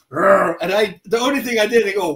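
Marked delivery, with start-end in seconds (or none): 0.1-0.6 short, louder mock growl; imitated dog roar. Revived Rottweiler seeing his attacker, imitated roar. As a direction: growl like a dog waking up angry. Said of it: "he sees me and he goes".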